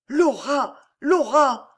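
high, urgent gasped name; desperate, exhausted warning. A narrator's reading. Alice warns the count that Laura may hear, desperate warning. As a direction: gasp the name high, at the end of your strength. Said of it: "souffla Alice à bout de force".

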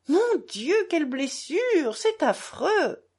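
high, shocked gasp of alarm; alarmed cry. A narrator's reading. Mother seeing the bitten finger, cries out in alarm. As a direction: cry out at the sight of blood. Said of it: "Madame Koklakov poussa un cri".

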